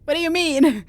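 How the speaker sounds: normal talking voice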